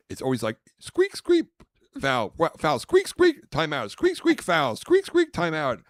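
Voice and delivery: high squeaky sneaker squeals and calls; mocking basketball as squeak after squeak. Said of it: "I'm like".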